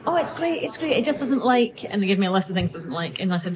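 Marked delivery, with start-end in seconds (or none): 0.1-1.8 lightly voiced cheerful owner, higher; a touch of a dismissive owner; mock breezy reassurance; breezy dog owner. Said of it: "And then she said".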